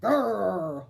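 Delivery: low growling roar from the bear. A narrator's reading. Old Bear growls at Bubba Braina, a roaring growl. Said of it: "Old Bear roared".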